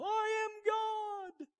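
high, slow, booming mock decree; mock grand proclamation. Self-proclaimed god on a mountaintop, mock booming proclamation. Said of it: "they stand on a mountaintop and go".

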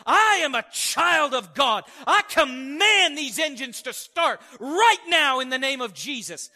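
slow, loud, commanding declaration; commanding shout. Retold or imitated. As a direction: proclaim it with full authority, loud and slow. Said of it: "my mom is in the seats next to me going".

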